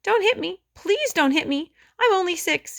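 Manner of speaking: put-on high, pleading little boy; crying plea. A narrator's reading. Frightened six-year-old begging Elaine, crying. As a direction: beg not to be hit, near tears. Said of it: "Daniel started to cry".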